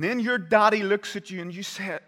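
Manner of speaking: loud, high, driving sermon voice; thundering pulpit preaching; preaching at full force. Acted. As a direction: preach it out to the congregation. Builds the Passover scene.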